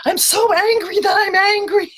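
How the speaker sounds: high, loud heat-of-the-moment venting; hammed-up angry outburst, high and loud; mock angry. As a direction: act out seething self-talk at full pitch. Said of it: "the heat of the moment".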